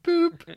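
high, loud cartoon boop; bright high button sound effect. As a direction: pop out a squeaky boop like a button press. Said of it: "and went".

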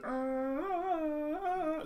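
high, sustained Tarzan call; imitated Tarzan cry on a steady note; Tarzan yell. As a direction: hold one long high jungle holler. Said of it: "that's his noise".

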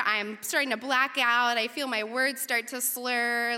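ordinary talk, nothing marked

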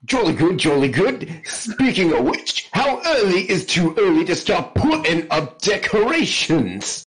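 hammed-up jolly-good British accent; mock English accent. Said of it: "his best English accent".

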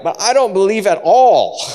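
high, loud confession of doubt; doubting outburst. Acted. Believer admitting doubt in the same breath, loud outburst. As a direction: blurt it out in mock despair.

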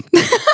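laugh. Real, not acted. Laughing at own story.

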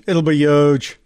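a quick mock Trump line; Trump impression. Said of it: "Everybody does the word huge".

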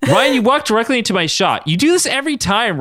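high-pitched indignant complaint; acted exasperated outburst; angry outcry. A narrator's reading. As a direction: scold him, pitch riding high. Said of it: "Katie cried out angrily".

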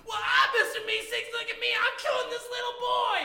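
bubbly high helper voice, shouted; Mister Meeseeks. Acted. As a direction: chirp about the murder like an eager servant. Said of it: "the other one says".

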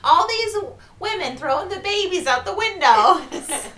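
high, loud hammed-up old-woman rant; griping Livia. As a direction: wail a mock complaint, close to laughing. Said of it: "Well, speaking of Livia".

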